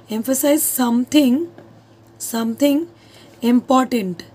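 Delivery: Emphasize something spoken very slowly for notes; slow dictation. Shown as a demonstration. Teacher dictating to her class, slow and deliberate. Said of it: "emphasize मतलब जोर देना".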